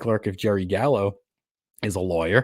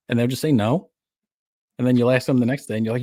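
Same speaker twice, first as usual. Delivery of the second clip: ordinary delivery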